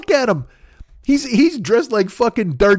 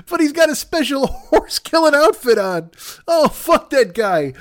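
laughing while talking; 8 semitones higher than usual. Real, not acted. Cracking up while describing.

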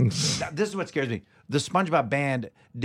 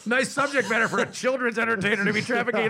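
mock disapproval; 6 semitones higher than usual. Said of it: "But they still go".

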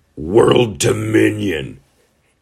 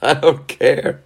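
high, defiant I don't care; mock defiance; 8 semitones higher, slower than usual. Vlogger shrugging off the agent, high and defiant. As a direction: toss it off like a sulky kid.

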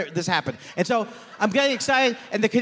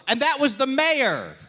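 higher, slow botched punchline; confused hack comic; 5 semitones higher, slower than usual. Acted. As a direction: land the wrong punchline slowly and proudly. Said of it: "Confused Hack Comic".

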